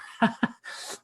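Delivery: short burst of laughter. Real, not acted. Host amused by an imagined listener's doubts, laugh.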